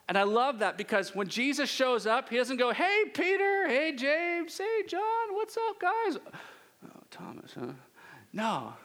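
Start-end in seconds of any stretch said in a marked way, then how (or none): 2.7-6.2 high, drawn-out hollered hello to the guys; over-the-top high-pitched buddy greeting; mock cheery greeting. Mock greeting Jesus doesn't give. 6.8-7.7 whispered, dropped-away reaction; hushed mock aside; mock muttered aside. Mock cool aside to Thomas.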